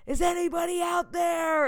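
high shout, calling out into emptiness; screaming into the void. Retold or imitated. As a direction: yell it out as if no one can hear. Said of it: "And I'm, like, screaming".

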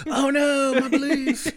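high, loud, hammed-up cry of lost faith; mock anguish. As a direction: wail it like your whole faith just broke. Acted quote, guy breaking his vow.

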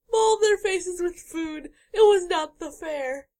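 whiny cartoon dog. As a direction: sob it out like a sad puppy. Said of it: "That was [name] doing a voice of my dog".

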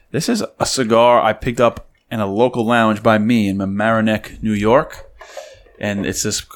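normal talking voice

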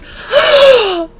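hammed-up, high, loud gasp; mock a terrible actress's shocked gasp; badly acted gasp. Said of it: "that awful acting".

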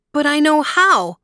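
put-on a small boy insisting he knows; eager child. A narrator's reading. Son pleading with his father the emperor, high eager child voice.

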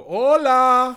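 "Hola!" shouted high and loud with a laugh. Acted. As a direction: bark "Hola!" like a scolding German. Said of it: "a German will say, "Hallo".

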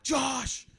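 high-pitched mock panicked yell; retold yelling. Waking Josh in drugged panic, mock yelling. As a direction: cry for help in a high, strained voice. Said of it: "and I was just yelling".

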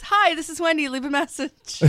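high, bright answering-machine greeting; sing-song it like a recorded message; voicemail greeting. Retold or imitated. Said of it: "it goes".

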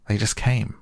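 a touch of breezy carelessness; careless. A narrator's reading. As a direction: toss it off lightly. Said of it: "explained Rabbit carelessly".

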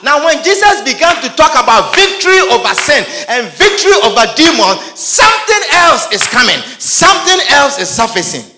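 rising to a shouted preach; preaching at full force. Acted. Preacher crying out to the congregation, loud. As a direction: build the preach up to a loud cry.

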